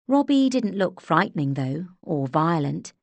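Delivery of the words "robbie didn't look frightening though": ordinary delivery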